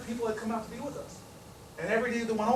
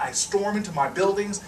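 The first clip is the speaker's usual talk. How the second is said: ordinary talk, nothing marked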